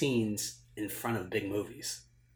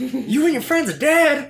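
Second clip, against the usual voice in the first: high-pitched, booming mock threat; acted shout; 12 dB louder than usual. Bank manager threatening the robbers, acted shout. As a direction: holler the threat like a movie character.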